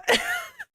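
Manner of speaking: shrill giggle burst; high laugh breaking through; high squeaky laugh. Real, not acted. Breaks after wail.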